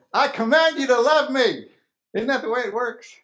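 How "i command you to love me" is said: mock command. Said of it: "go up to that girl or guy and say".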